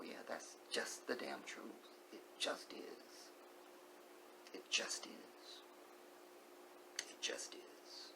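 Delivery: slow unvoiced whisper of acceptance; soft, slow whispered resignation; hushed realisation. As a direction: murmur it like a quiet truth sinking in. Speaker voices slow-motion realisation.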